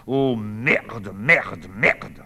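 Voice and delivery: loud cursing as you storm off; furious swearing. Acted. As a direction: swear on your way out. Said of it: "Olivier fonçant dans sa chambre". (jurons rageurs)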